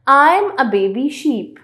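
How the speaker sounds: very slow, careful riddle read-aloud. Acted. Teacher reading a riddle to her class, very slow. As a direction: draw the riddle out slowly for the class.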